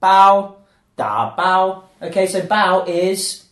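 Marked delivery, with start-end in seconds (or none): 0.0-0.5 bao said loud and clear on its own; a loud teaching bao; second syllable bao. Shown as a demonstration. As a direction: give bao a firm clear tone. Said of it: "the second syllable is bao".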